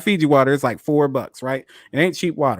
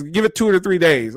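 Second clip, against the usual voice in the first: ordinary talk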